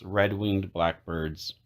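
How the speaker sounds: usual speaking voice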